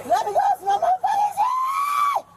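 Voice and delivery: high furious yell at officers; shouting. Real, not acted. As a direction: scream it out, furious. Screaming at officers during arrest.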